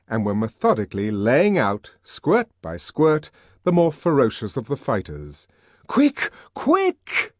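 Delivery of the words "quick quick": high, urgent yell. Acted. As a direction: yell at the police to hurry. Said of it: "yelled Bernard".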